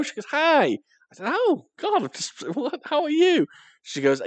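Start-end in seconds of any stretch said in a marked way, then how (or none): 0.3-0.7 very high, loud hi; imitated girl's greeting. Girl greeting the boy on the phone, high-pitched hi. 1.3-3.5 squeaky, high, giggly fluster; retold flustered delight. Boy thrilled that she rang, high flustered greeting.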